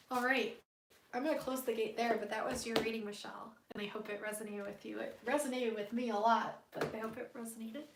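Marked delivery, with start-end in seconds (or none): 3.1-5.0 fast breathy whisper. Real, not acted. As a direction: whisper it quickly. Whispers to viewer.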